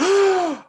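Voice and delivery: high, imitated gasp of shock; crowd gasp. Imitating hundreds of onlookers at the intersection, shocked gasp. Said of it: "They're like".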